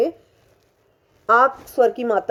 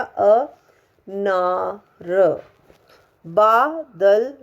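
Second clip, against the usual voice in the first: Badal stretched slowly syllable by syllable, rising at the end; Badal said very slowly with a rising finish; drawn-out reading; much slower than usual. Shown as a demonstration. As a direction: spell out Badal slowly for a child. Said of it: "reading word slowly for pupils".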